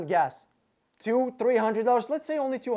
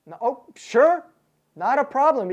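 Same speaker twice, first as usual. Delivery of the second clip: sarcastic; 5 semitones higher, 5 dB louder than usual. Retold or imitated. Sarcastic agreement.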